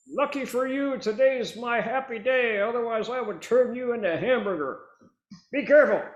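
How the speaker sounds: high, loud, mock-menacing biker voice; threatening biker. Biker warning off a driver, high loud threat. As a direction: shout the threat as the tough guy.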